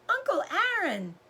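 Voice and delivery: put-on a girl calling out to her uncle, delighted; joyful cry.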